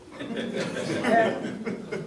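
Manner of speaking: a real burst of breathy laughter; hearty laugh. Real, not acted. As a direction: laugh out loud at the joke. Laughs at his own joke.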